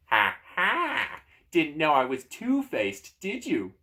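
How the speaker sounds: smug, loud gloating with a mock laugh; crowing talking door. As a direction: gloat in a drawn-out, lifted voice. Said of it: "the door says smugly".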